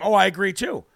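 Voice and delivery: ordinary talk, nothing marked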